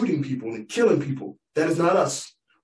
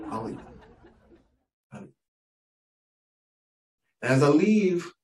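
usual speaking voice

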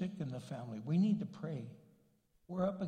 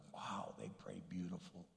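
whisper; 10 semitones lower, 11 dB quieter than usual. Retold or imitated. Admiring someone's grand prayer.

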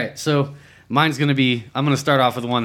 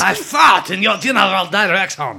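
mock French taunt; 6 semitones higher, 3 dB louder than usual. Quoting Monty Python taunts.

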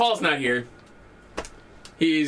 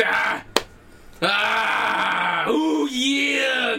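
high, shouted wrestler catchphrase; Macho Man; 6 semitones higher, much slower than usual. Pretend. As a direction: drag it out like a hyped-up wrestler. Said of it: "already into Macho Man".

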